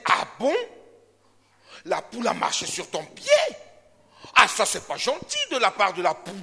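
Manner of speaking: hammed-up scandalised cat; shocked gossip. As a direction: gasp at the juicy news, laughing a little. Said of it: "Et le chat aussi va dire".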